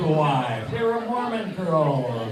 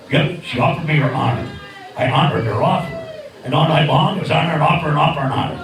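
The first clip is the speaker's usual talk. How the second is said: puppet bird voice; 6 semitones lower than usual. Acted.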